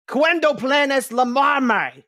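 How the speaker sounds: loud, high-pitched yell in Spanish; mock screaming. Game master voicing a mom yelling into the phone, screaming. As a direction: scream it back down the phone, drawn out. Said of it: "you hear her screaming back to you".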